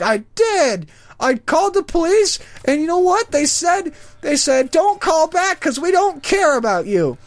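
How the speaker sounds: high, aggrieved old lady impression; put-on indignant old lady; elderly woman. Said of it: "it's this old elderly woman".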